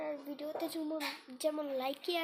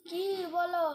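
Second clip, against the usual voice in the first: calling out; 5 semitones higher, 6 dB louder, slower than usual. Real, not acted. Answering mother calling from away.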